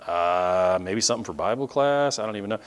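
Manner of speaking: low, soft, vague mumble; hesitate, then let it drop away; mock sheepish answer; unsure student.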